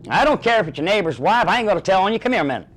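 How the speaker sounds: loud, high barker holler; scream across the midway. Retold or imitated. Barker yelling across the midway at a passing man. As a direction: yell across the fairground to a guy. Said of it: "I would scream across the midway".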